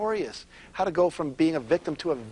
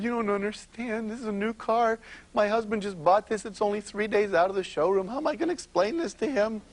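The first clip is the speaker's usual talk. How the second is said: higher-pitched, pleading distressed woman; a touch of a tearful wife, voice raised high; upset tearful woman; 6 semitones higher, 3 dB quieter than usual. Acted. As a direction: plead like she's about to cry over the car. Crying woman voiced in story.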